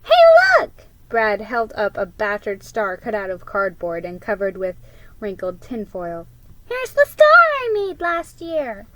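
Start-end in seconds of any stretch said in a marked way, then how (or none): 0.0-0.7 very high, loud shout of discovery; excited. Acted. Boy finding his star in the box, high excited shout. 6.7-8.9 put-on high, louder proud boy voice. Boy showing his cardboard star, high proud voice.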